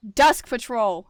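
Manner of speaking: loud, slow drawn-out call. A narrator's reading. Deputy summoning the dusk patrol, called loudly. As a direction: call the patrol across camp. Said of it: "he called out".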